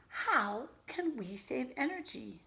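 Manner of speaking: normal talking voice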